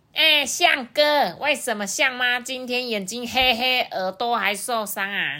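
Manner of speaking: high, loud, stretched crow voice; 多嘴乌鸦. A narrator's reading. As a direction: call out nosily from the air. Nosy crow calls to brother. (乌鸦高声拖长音地追问)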